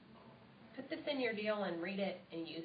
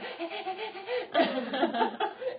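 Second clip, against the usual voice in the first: high, louder breathless laughing; 10 semitones higher, 6 dB louder than usual. Shown as a demonstration. Showing hostesses how to sound pumped, breathless laugh. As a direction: laugh like you just ran up the stairs. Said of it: "come back in and be like".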